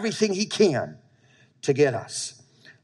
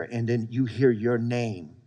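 ordinary talk, nothing marked

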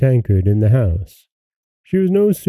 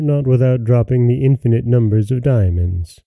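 plain reading voice

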